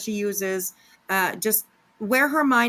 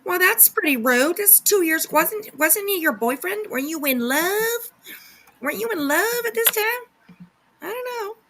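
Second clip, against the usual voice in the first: mocking; 10 semitones higher than usual. Mocking absent boyfriend.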